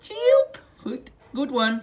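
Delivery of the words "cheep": bird chirp. Retold or imitated. Mother copies bird noise.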